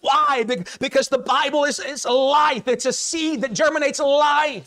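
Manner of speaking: high-pitched sermon delivery; full-force preaching. Real, not acted.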